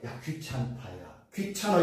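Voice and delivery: soft, lightly acted brush-off; mock annoyance. A person fed up with repeated requests, mildly annoyed. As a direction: wave them away in a low, weary undertone. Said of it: "사람은 뭐라?".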